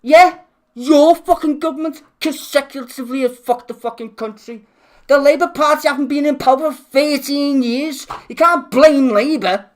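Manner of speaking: heated political rant; angry rant. Real, not acted. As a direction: vent the fury at the government. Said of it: "I needed that rant".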